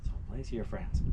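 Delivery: ordinary talk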